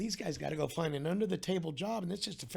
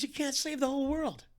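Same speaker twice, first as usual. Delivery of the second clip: higher, louder preachy pastor voice; imitating the pastor; 10 semitones higher, 5 dB louder than usual. Quoting the pastor's rebuke, higher and louder. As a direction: proclaim it like a lecturing pastor. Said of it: "He said".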